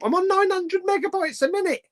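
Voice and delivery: mocking a viewer's frozen internet; mock indignant. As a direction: squeak it like a put-upon viewer.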